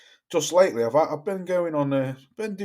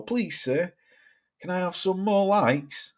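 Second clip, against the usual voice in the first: mock beggar's plea, higher and softer; pleading beggar; 5 semitones higher, 3 dB quieter than usual. Streamer mocking channels that beg for likes, pleading beggar. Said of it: "with me be-, begging bowl".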